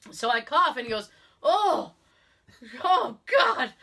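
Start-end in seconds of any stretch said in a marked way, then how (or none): 1.4-3.7 high, loud "oh God" of dismay; imitated appalled outburst; appalled reaction. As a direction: react with mock horror, louder and higher. Caller reacting to her cough.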